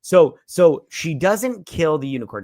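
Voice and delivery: ordinary talk, nothing marked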